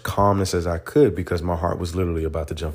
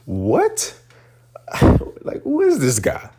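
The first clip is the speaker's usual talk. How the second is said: high, disbelieving laugh-through reply; incredulous; 11 semitones higher, 4 dB louder, slower than usual. Retold or imitated. To the Master Gunner Sergeant, stunned laughing disbelief. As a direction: sound thrown off by the question, laughing in disbelief.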